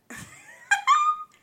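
loud high-pitched laugh. Real, not acted. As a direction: burst out laughing at yourself. Laughs at own singing.

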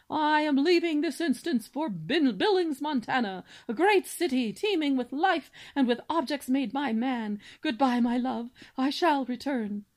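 pompous cob swan. Acted. Father swan listing his son's virtues, grandly booming. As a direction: declaim like a self-important father swan.

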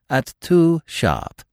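slow and deliberate, each word set down; quiet firmness. Acted. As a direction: insist gently but without give. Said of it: "said Baxter with quiet firmness".

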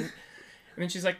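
usual speaking voice

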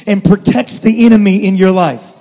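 loud, driving sermon delivery; full-force preaching. Acted. Pastor preaching hard to his church, loud and driving. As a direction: preach it out to the congregation.

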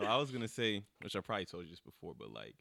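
normal talking voice

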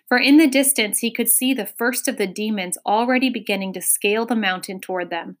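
ordinary delivery, nothing marked

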